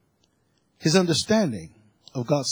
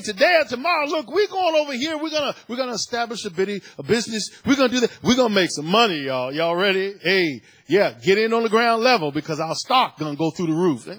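hyped-up go-getter pitching a deal, higher; boastful investor; 6 semitones higher, 4 dB louder than usual. Acted. Preacher mimicking worldly believers bragging about business plans, hyped-up. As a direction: sell it like a hustler chasing profit.

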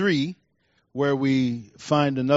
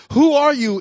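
startled; 9 semitones higher, 6 dB louder than usual. Retold or imitated. Boaz startled awake at night.

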